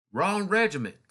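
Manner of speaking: loud high Southern retort; a Southern sentry catching a spy, loud; Southern accent. Retold or imitated. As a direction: snap it back in a Southern accent. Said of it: "talking in a Southern accent".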